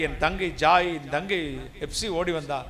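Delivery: ordinary talk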